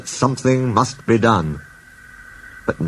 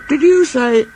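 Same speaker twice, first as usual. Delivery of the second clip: thoughtful; 12 semitones higher than usual. Acted. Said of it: "asked Duck thoughtfully".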